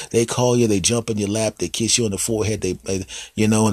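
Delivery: ordinary talk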